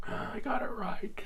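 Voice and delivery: high, breath-filled little squeal; mock amazed delight. Test-taker finds his doubtful guess was right, high amazed squeal. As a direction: squeak it out, thrilled at your luck.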